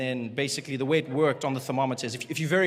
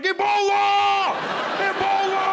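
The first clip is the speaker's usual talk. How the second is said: very high, loud mock yell; panicked shout; 6 dB louder, much slower than usual. Frightened passenger screams at the cougher, very high mock yell. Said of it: "he was like".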